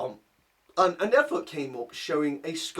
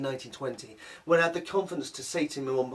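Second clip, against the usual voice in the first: normal talking voice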